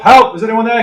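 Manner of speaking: loud, high shout for help. Shown as a demonstration. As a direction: yell out for help. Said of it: "so I'm gonna shout for help".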